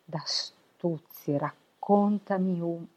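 slow, stumbling, word by word; halting reading aloud. Acted. Odino reading the Odyssey aloud, unpractised, slow and stumbling. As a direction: sound out each word, stopping and restarting. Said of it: "ma non ti sei esercitato per niente".

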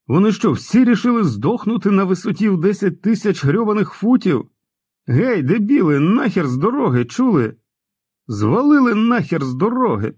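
high-pitched furious tirade; raging. A narrator's reading. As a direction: yell in a rage at idiots blocking the road. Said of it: "лютував пацан".

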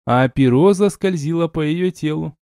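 ordinary delivery, nothing marked

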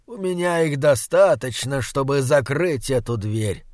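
ordinary delivery

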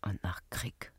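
breathed, very soft; whisper. Acted. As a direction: murmur it close to his ear. Leise, geheimnisvolle Antwort. (geflüstert, ganz leise)

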